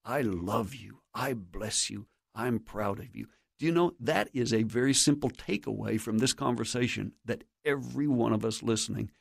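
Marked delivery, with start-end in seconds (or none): 0.0-3.3 soft, low, sincere fatherly affirmation; tender blessing. Acted. Parent affirming a son, soft and tender. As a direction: bless them quietly and tenderly. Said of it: "then tell them what God says about them".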